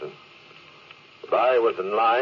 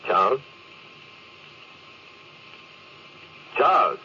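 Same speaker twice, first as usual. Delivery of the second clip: calling out, growing panic; 8 semitones higher than usual. Acted. Officer calling his silent crewmate over radio, rising panic. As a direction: call again and again, more frantic.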